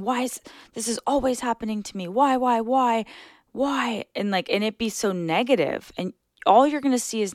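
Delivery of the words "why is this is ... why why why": victim whine. Shown as a demonstration. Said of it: "'Cause if you're saying".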